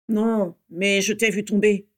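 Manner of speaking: lightly voiced gruff man, slow; a hint of gruffness, unhurried. A narrator's reading. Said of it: "dit une voix bourrue". (voix légèrement bourrue, lente)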